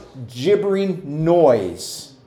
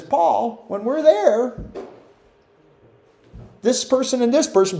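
reporting to Paul; 6 semitones higher than usual. Retold or imitated. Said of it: "the house of Chloe comes and says".

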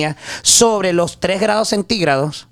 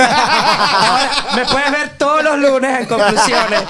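laughing through a joking boast; amused, laughing brag; 4 semitones higher than usual. Real, not acted. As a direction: chuckle while bragging about the anchor job. Joking about replacing news anchor.

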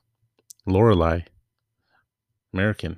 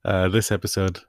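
usual speaking voice

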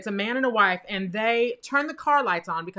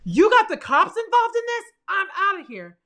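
high, loud mock drunk outburst, bailing on them; panicky drunk guy; 9 semitones higher, 5 dB louder than usual. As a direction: play the spooked drunk who yells and runs. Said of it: "he was like".

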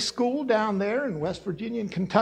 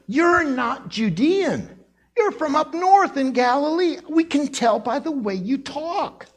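higher, drawn-out accusation; single him out with a raised, emphatic voice; accusing; 7 semitones higher than usual. Acted. Crowd tells Peter his talk gives him away, accusing.

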